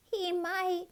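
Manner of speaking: high, wistful squirrel voice; sighing squirrel. A narrator's reading. Squirrel agreeing with sad cat, sighing. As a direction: sigh it out high and small. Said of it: "sighed the squirrel".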